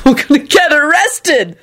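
high, slow, gleeful squeal; joyful scream. A narrator's reading. Shouting to his mate about being arrested, joyful. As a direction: squeal it with glee. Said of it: "Neville screamed joyfully".

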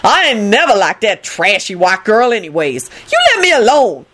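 dismiss, then demand to be left alone; disdainful. A narrator's reading. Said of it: "Lucretia replied disdainfully".

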